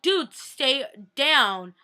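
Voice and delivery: slow, loud, drawn-out scolding; exasperated order. Retold or imitated. Annoyed at something that kept moving, exasperated order. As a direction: stretch each word in annoyance.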